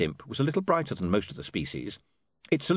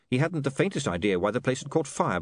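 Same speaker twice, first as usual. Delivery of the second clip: ordinary delivery, nothing marked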